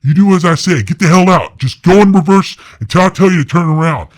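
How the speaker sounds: high, loud barked orders; commanding yell. Retold or imitated. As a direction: take charge and yell every order. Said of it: "Jeremiah's just yelling".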